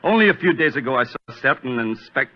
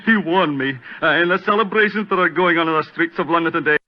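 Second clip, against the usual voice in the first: frightened pleading; 4 semitones higher than usual. Acted. Frightened man pleading his case.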